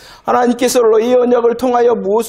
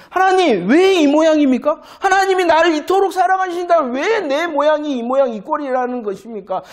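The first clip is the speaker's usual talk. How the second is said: higher, exasperated complaint to God; complaining lament; 8 semitones higher than usual. Retold or imitated. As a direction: plead against your own lot. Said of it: "people complaining to God".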